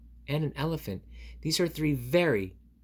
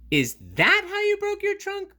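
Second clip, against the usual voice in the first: much higher, louder piggy question; 8 dB louder than usual. Acted. Piggy asks Gerald, high squeaky voice. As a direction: ask it in a high squeaky pig voice. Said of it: "Piggy asks".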